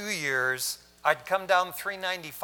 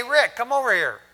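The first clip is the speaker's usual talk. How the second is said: higher, louder call across the office; 7 semitones higher, 6 dB louder than usual. Retold or imitated. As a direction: call him over from the next room. Boss calls him over.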